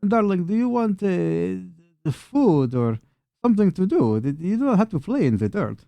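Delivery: put-on high, lilting old-woman character voice; high-pitched elderly hostess; kindly old townswoman. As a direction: voice an old wife offering help, high and swooping. Game master voices Anna.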